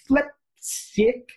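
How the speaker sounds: high, loud, mock-cheery substitute swear; cleaned-up curse. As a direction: swap the curse for a silly high yelp. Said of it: "the amount of times I've gone".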